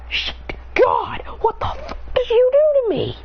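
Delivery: put-on a boy yelling at God through sobs; furious crying yell. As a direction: scream at God through tears. Transformed back, yells at God.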